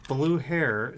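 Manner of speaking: ordinary talk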